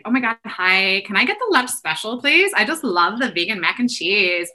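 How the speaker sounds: put-on Valley Girl accent. Irish customer fakes an accent to get lunch understood, mock Valley Girl. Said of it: "do a Valley Girl accent".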